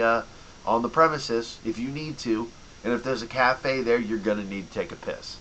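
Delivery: normal talking voice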